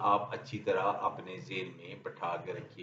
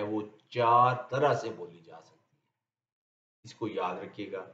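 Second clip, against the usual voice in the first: ordinary talk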